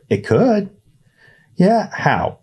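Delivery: put-on high, hopeful kid voice; a naive boy insisting; lightly voiced eager boy. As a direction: Ernest insisting to Ryan. Ernest and Ryan bickering.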